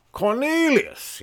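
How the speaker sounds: high, drawn-out posh British name; hammed-up British, stretching the name; British accent. Hobo gives his name to a passerby, hammed-up British accent. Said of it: "they never have British accents".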